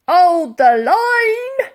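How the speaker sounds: barked order. A narrator's reading. Barker ordering his men, shouted order. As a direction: hold the men firm. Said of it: "ordered Barker".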